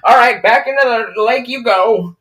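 high, loud fisherman voice. Retold or imitated. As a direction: call it out like a cheerful fisherman.

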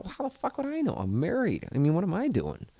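retold, high-pitched mock outrage; a high squeak of "how would I know"; exasperated disbelief. Married man answering his Tinder friend, high and incredulous. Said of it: "I go".

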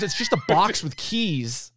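slow, lightly voiced old lady; old lady impression. Retelling the sketch, slow mock old lady voice. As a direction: drawl it out like the pushy old lady. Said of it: "She goes".

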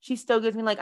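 usual speaking voice

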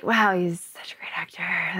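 hushed, whispery inner thought; awed murmur. Retold or imitated. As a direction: say it softly to yourself in wonder.

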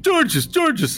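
very high half-ogre squawk; Georges Gorgeous. Acted. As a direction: call out the name in a piercingly high creature voice. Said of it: "and he's going".

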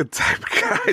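giggling repeat of his own phrase; laughing. Real, not acted. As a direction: crack up while repeating it. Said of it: "I'm a wait and see type of guy".

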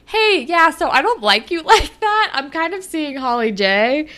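high, loud, uneasy letdown; awkward Sav. Retold or imitated. Sav turning Jenna down, lightly high. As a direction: let her down awkwardly. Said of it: "Sav was like".

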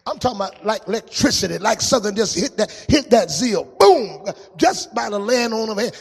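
a preacher's fiery build; full-force preaching. Acted. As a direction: drive the church into praise.

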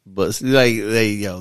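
ordinary delivery

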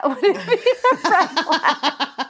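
helpless laughter through the line; breaking up laughing. Real, not acted. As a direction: crack up mid-sentence.

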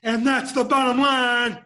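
loud wrestler promo shout; Stone Cold. Acted. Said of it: "'cause Stone Cold said so".

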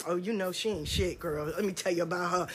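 hushed low gossip; catty gossip. Retold or imitated. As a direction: lean in and trash her quietly. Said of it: "I seen more motherfucking Benita".

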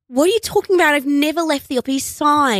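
put-on failed American accent, loud and high; mock an actress's non-American accent playing a New Yorker; Nicole Kidman. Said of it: "refuses to do an American accent".

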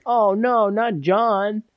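lightly hammed-up regret; mock dismay.

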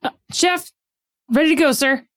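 high, keen reply to the chef; eager rabbit cook. Acted. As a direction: report in like a nervous new cook. Hopscotch reports to head chef.

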